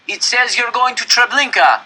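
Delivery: high, loud shout across the gap; calling out. A narrator's reading. Telling the other train its destination, loud shout. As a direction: make sure they hear you.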